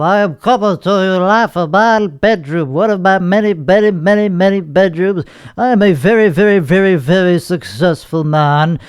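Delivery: wobbling, mock-grand nasal boast; ultra nasally voice. Host jokingly boasts to listeners, ultra nasally. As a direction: brag through your nose in a hammed-up, wavering voice. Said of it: "ultra nasally voice".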